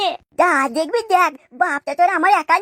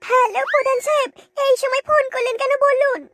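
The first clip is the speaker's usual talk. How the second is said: higher, shouted phone greeting; 6 semitones higher than usual. Acted. (ফোনে চেঁচিয়ে কথা)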